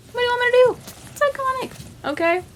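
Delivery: very high squeaky comic voice; playful helpless protest. Pretend. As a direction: plead it way up high, mock-defensive. Comic helpless defence of her pick.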